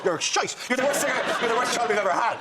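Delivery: high, hammed-up tirade; mock parental scolding. X Factor parents rejecting their kicked-out child, mock scolding. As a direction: turn on the child with comic outrage. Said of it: "And you go".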